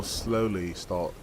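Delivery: slow, careful British enunciation; slow enunciating. Shown as a demonstration. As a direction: drag out each word, crisp consonants. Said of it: "slowly start enunciating like this".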